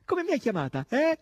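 high cry ending on a loud challenge; demanding shout. A narrator's reading. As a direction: shout the question, punch the final word. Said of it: "gridò Natascia". (domanda gridata in tono acuto)

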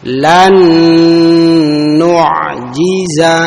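Lan nu'jiza with a full, clear ain in the throat. Shown as a demonstration. Teacher modelling the ain for students to copy. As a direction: sound the ain fully in nu'jiza. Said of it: "sempurnakan pengucapan ain".

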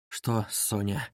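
quiet, slow, uneasy question; trembling voice. A narrator's reading. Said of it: "что голос его дрожит".

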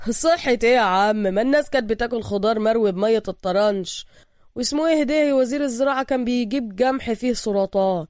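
loud, brash Egyptian worker; street worker voice. Acted. Worker brushing off Bruce's health concerns, brash. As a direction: dismiss him with swagger. Voicing Abu Rahma.

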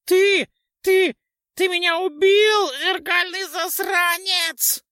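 very high, loud, slowing ghoul shriek; dying ghoul scream. A narrator's reading. Ghoul screams at the knight who killed him. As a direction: shriek out the betrayal, cut in half. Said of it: "прокричал поганец".

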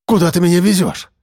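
uneasy and on guard; wary. A narrator's reading. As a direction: ask with sudden suspicion. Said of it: "насторожился Андрей".

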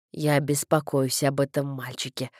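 low, confiding clergyman; concerned vicar. A narrator's reading. Vicar confesses his worry to father, low concerned voice. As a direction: voice the worried vicar, pitched well down.

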